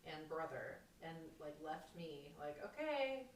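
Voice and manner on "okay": high, loud mock-cheerful goodbye; breezy send-off. Husband leaving wife behind for Nepal, mock-breezy. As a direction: call out a carefree farewell, imitating him.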